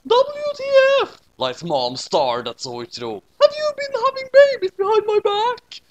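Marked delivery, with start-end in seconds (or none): 0.1-1.2 very high, loud shriek; outraged shriek. Acted. Mom shrieking at her husband, very high and loud. 3.4-5.8 very high, loud accusing scream. Acted. Wife screaming at her husband over the baby, high and loud. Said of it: "she screamed".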